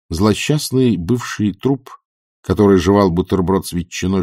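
plain reading voice

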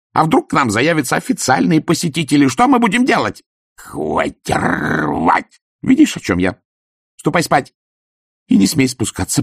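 high, loud tirade; furious scolding. Acted. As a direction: tear into him like a shrewish wife.

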